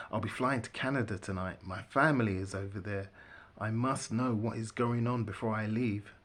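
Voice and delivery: usual speaking voice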